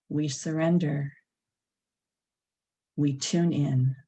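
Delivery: unhurried, spacious meditation leading; guided meditation. Acted. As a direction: lead them gently into stillness, phrase by phrase.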